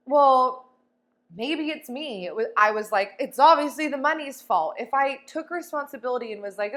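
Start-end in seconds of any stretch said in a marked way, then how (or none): 0.1-2.3 loud, high, hammed-up dawning guilt; mock dread. Acting the dreaded self-blame. 3.2-4.7 louder, higher, smug blaming; mock excuse. Acting her old excuse.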